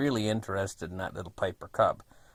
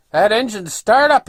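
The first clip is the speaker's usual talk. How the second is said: raised, loud warning voice; imitating an old fellow yelling; retold yell; 11 semitones higher, 10 dB louder than usual. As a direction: holler the danger at someone. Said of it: "he's yelling over at me".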